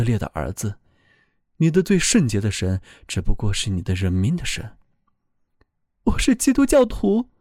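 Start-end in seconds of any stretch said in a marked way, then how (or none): 6.0-7.3 higher, earnest voice of a frightened soul; pleading soul voice. A narrator's reading. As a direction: speak up as a soul defending itself. Soul insists.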